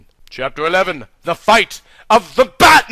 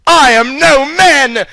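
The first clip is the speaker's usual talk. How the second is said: loud, higher, slow battle cry; yelled; 8 semitones higher, 6 dB louder than usual. Acted. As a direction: yell it out slowly in defiance. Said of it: "yellowed Aeowyn".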